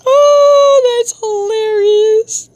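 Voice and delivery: drawn-out, loud high squeal easing off; high amused wail. Real, not acted. Laughing reaction to chat.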